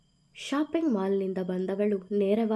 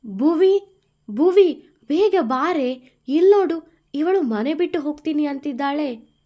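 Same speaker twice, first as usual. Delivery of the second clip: high, loud call to someone in another room; urgent calling out; 6 semitones higher, 10 dB louder than usual. A narrator's reading. Shambhavi calling Bhuvi in alarm, loud and high. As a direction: shout for help, alarmed.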